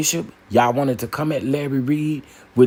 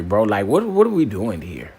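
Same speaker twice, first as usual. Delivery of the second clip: normal talking voice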